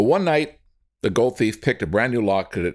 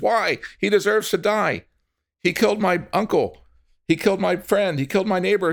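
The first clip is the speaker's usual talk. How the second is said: high, raised protest; indignant; 10 semitones higher than usual. Acted. Relative protesting to the crowd, high and indignant. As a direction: demand justice, hurt and angry. Angry relative wants revenge.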